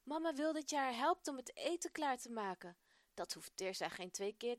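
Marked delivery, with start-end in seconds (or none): 0.0-2.8 higher and louder, panting from running; out of breath. A narrator's reading. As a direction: gasp it out after a hard run. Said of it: "Hijgend staat Tirza". (hijgend en buiten adem na het rennen)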